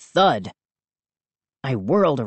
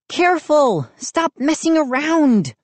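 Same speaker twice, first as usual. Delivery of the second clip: put-on high, urgent girl's voice; warning girl; 12 semitones higher than usual. A narrator's reading. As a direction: warn them off in a high pitch. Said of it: "Mindy warned".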